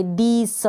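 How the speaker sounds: "दीस" with the final s sounded out; demonstrated mistake, "दीस" with an s; wrong S ending. Shown as a demonstration. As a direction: end "दीस" on s instead of z. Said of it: "इस एस को स पढ़ दें".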